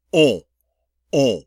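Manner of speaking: "On, on," loud nasal o, no n; French nasal vowel. Shown as a demonstration. Teacher shows off beautiful nasal sound, loudly modelled. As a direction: ring out "On, on," as separate nasal vowels. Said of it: "I get to use a beautiful nasal sound".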